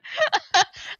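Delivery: sudden high-pitched laugh. Real, not acted. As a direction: burst out laughing. Laughs at missed prediction.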